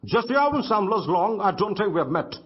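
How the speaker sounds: a light German accent with crisp D and T. Shown as a demonstration. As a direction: say it like a German speaking English, hard consonants. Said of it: "Germans would have said it like this".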